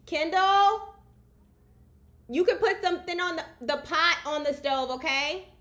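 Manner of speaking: real yelled household instruction; calling out. Real, not acted. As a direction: holler directions so they carry through the house. Shouts instructions to someone off mic.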